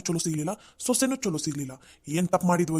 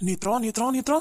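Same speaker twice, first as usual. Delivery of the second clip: calling out angrily; 5 semitones higher than usual. Acted. Husband calling wife angrily.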